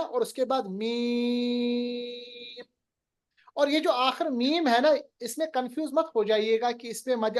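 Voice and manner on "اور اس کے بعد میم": میم held long with humming nasal sound; nasal hold on meem. Shown as a demonstration. Teacher showing ghunna, میم hummed long. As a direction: let میم ring through the nose, slowly. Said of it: "کیونکہ میم پہ تشدید ہے نا".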